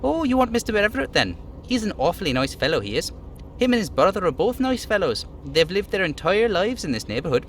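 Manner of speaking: lightly voiced friendly worker; chatty little worker praising neighbours; little dockhand voice. Voicing the little guy.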